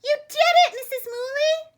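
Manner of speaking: high, loud cheering; shout. A narrator's reading. Barnyard animals cheering Missus Mooley, high and loud. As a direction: cheer it out together. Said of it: "they all shouted at once".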